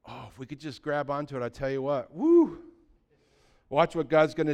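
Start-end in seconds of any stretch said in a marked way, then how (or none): 2.1-2.6 a high, loud woo; joyful whoop. Real, not acted. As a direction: let out a happy cheer. Preacher's burst of joy.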